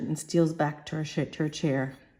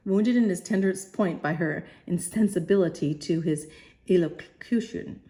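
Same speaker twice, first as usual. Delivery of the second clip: normal talking voice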